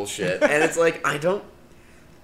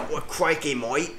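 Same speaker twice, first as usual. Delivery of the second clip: mock Australian accent; 3 semitones higher than usual. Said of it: "he's in Australia".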